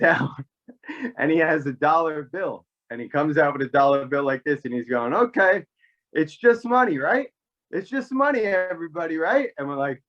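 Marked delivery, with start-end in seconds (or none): 5.1-9.5 high-pitched goading grandfather imitation; needling, put-on high voice; mock-agreeable taunting. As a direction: taunt the whole room in a raised pitch. Grandfather acted out, mockingly proving point.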